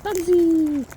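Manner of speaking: high sung-out call to the bird. Real, not acted. Calling bird by name.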